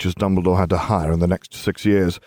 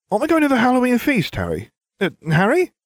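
put-on a much higher, fast eager boy; Ron's eager voice; 3 dB louder, faster than usual. A narrator's reading. Ron begging Harry to come to the feast, high and eager. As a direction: chatter brightly at your friend.

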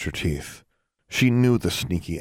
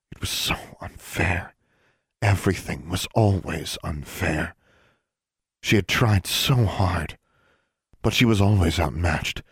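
usual speaking voice

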